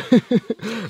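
burst of laughter. Real, not acted. Reacting to the coincidence.

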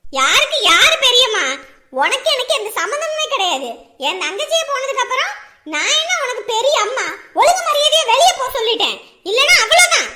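loud furious scolding; angry shouting. Acted. Aunt yelling at estranged daughter to leave, furious shout. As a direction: drive her out with a shout.

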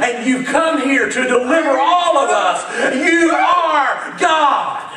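fervent declaration. Acted. Peter declaring to Jesus, rising to a shout. Preacher voicing Peter, building.

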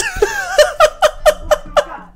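shrieking laughter; high-pitched laugh. Real, not acted. Reacting to funny clip.

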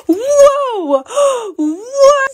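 gasp, then a loud high cry of amazement; real startled gasp and shout; shocked gasps. Real, not acted. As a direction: react as if the cake just exploded. Surprise revealed inside cake.

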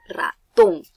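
"ratón" with TÓN punched high and loud; stress on last syllable. Shown as a demonstration. Teacher showing pupils where "ratón" is stressed, loud high final TÓN. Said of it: "tiene la mayor fuerza de voz en la ton".

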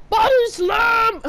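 high, loud and slow, drawn out; hype shout. Real, not acted. Gamer yelling the move as he hits it, slow hype shout. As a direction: bellow it like a wrestling announcer. Shouts it after quiet take.

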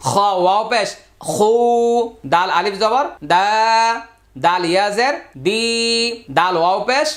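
rising chanted teacher drill, each syllable held; sing-song letter drill. Acted. Teacher chanting the letter drill to students, sing-song and rising. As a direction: chant the spelling drill for the class.

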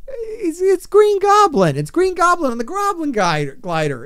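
cracking high then loud in frustration; exasperated outburst. Real, not acted. Streamer upset he can't show the team, cracking exasperated outburst. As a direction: voice breaks up high, then bursts out.

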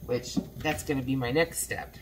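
ordinary talk

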